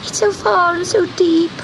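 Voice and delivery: high, tearful kitten voice; a hint of a sob in a tiny voice; sobbing kitten. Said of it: "sobbed Gobelino".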